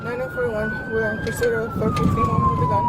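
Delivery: tinny police radio chatter; crackly military radio. Real, not acted. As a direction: heard through a crackly two-way radio. Police radio dispatch call.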